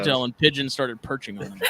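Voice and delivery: ordinary delivery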